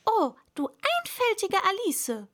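high-pitched telling-off; exasperated self-reproach; scolding herself. A narrator's reading. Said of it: "schalt sie sich selbst".

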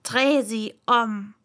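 slow, firm annoyed order. Acted. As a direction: snap the order in irritation. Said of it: "ärgerlich".

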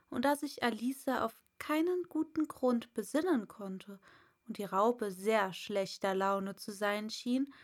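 usual speaking voice

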